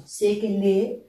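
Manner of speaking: SEKELE with the vowel dragged, rising at the end; drawn-out question. Shown as a demonstration. Teacher showing where to drag "sekele", lengthened vowel and rising question. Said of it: "on traîne ici".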